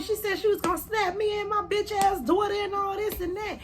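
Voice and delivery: high indignant complaint; indignant self. Retold or imitated. Explaining to the brother she was invited, high indignant. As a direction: protest it to the brother. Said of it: "I said".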